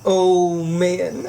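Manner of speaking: normal talking voice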